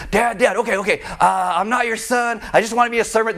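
high, hurried mock pleading; flustered pleading. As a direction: play a flustered son stumbling through his apology. Acts son pleading with dad.

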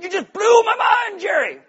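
high, loud sitcom outburst; Kramer. Acted. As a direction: shout it in wild, high-pitched amazement. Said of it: "Kramer walks in".

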